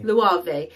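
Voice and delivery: "Loewe" as loo-ah-vay; three parts in "Loewe", ending vay; correct Loewe. Shown as a demonstration. Demonstrating the real brand name, Loewe as loo-ah-vay. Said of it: "But it is said Loewe".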